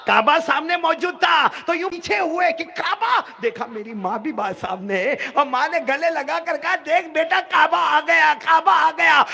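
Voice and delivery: high, loud, joyful cry; preacher crying out the Kaaba's arrival; excited climax. A narrator's reading. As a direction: shout it out in wonder.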